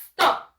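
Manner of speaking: loud, high "Stop" with a long initial S; correct "stop" pronunciation. Shown as a demonstration. Teacher modelling the word for students, "Stop" pronounced from a pure S. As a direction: say "Stop" straight from the S, no E in front. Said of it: "Así es la pronunciation".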